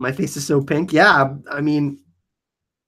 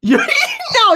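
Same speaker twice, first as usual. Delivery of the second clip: laughing; 4 dB louder than usual. Real, not acted. Reacting to embarrassing chat message.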